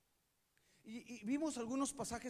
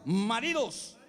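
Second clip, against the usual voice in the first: Maridos called out louder, with full chest; strong voice; 8 dB louder, slower than usual. Shown as a demonstration. Pastor showing the men how to say it, strongly. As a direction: say Maridos like you mean it. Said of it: "con esa voz así".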